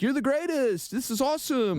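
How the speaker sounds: sarcastic hype-man voice; mock flattery. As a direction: gush like a yes-man boss. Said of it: "And just be like".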